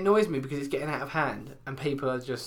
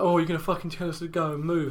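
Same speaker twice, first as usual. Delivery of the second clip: lads' mock taunt; 3 semitones higher than usual. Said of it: "they were like".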